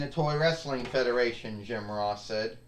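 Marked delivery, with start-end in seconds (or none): none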